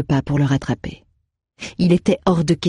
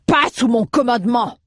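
firm interjection; 8 semitones higher than usual. Acted. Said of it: "s'interposa Alroeg".

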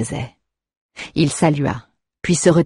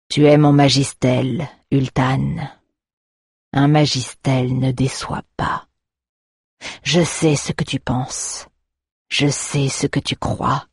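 lightly voiced menacing master; cavernous voice. A narrator's reading. Master reads warrior's thoughts.

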